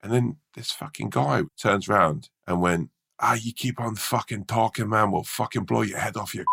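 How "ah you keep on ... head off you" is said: a hint of a Scottish accent, menacing heckler; mock threat in a lightly done Scottish voice; rough Scottish heckle. Drunk Scottish heckler threatening the comedian, lightly done Scottish accent. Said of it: "Scottish accent".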